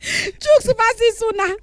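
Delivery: mock crying.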